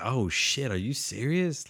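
replaying his reaction to the stabbing; shocked disbelief. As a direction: gasp it out like you can't believe it. Reliving shock at the death.

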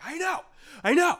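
mock eager agreement. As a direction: trill it, overeager to agree. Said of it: "the movie of being like".

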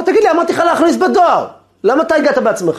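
high, indignant telling-off; angry scolding. Retold or imitated. Rabbi confronting the man who came himself, angry scolding. Acted scolding at unexpected arrival.

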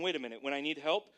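ordinary delivery

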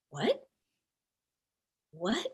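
soft, rising question, stunned; small questioning voice of shock; quiet disbelief. Retold or imitated. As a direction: recall hearing terrible news, barely voicing it. Retelling reaction to father's death.

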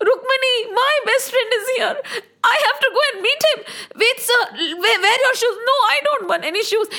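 high, breathless-with-joy excitement; acted joyful rush; overjoyed urgency. Krishna rushes to meet his friend.

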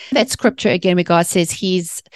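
ordinary delivery

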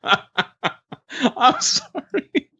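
high, stretched words through laughter; laughing apology. Real, not acted. Co-host apologizes to Pete after teasing him, laughing hard. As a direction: squeal it out while cracking up.

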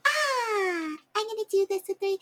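squeaky high put-on voice, imitating a show-off; quick mocking squeal of a voice. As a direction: pitch way up like a silly classmate. Mocking someone at school entrance.

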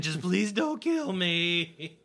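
high, slow desperate whimper; begging for my life. Acted. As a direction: plead with eyes shut, slowly. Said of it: "eyes closed, pleading".

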